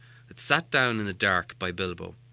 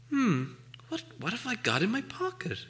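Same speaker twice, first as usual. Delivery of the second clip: musing aloud; 10 semitones higher, 6 dB quieter than usual. Acted. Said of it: "he said aloud".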